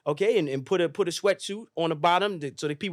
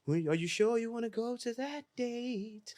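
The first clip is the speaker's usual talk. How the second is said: soft, slow, higher sultry woman's voice; 6 semitones higher, 9 dB quieter, slower than usual. Retold or imitated. The time machine voice asking him, soft slow sultry croon. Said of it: "sounding like, uh, uh, [name]".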